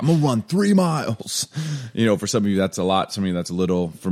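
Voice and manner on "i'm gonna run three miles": higher, eager boast; overconfident hype. Retold or imitated. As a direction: puff up like a prize fighter. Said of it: "And I'm like".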